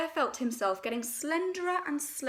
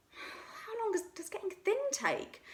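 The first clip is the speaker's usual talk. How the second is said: higher, worried small voice; anxious; 11 semitones higher, 5 dB quieter than usual. A narrator's reading. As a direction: fret about how long. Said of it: "asked Pooh anxiously".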